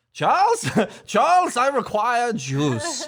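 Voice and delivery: put-on high, slow, pompous old gent through laughter; old British man. Parent imitates daughter demanding juice like a British gentleman. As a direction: ham up a prim British butler request. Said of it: "she talks like an old British man".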